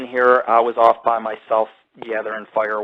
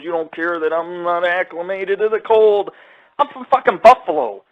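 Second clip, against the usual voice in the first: high, louder whiny mockery; mocking whiny complaint; 6 semitones higher, 3 dB louder than usual. Imitating the shivering hiker's whine, mocking. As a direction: mimic a complaining buddy. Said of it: "He goes".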